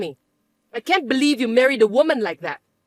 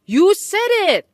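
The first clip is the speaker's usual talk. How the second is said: slow, loud, higher accusation; angry insistence; 8 semitones higher, 5 dB louder, slower than usual. Acted. Mother accusing her son of saying it, angry. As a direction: pin him down with it.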